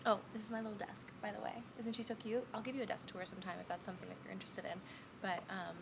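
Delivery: normal talking voice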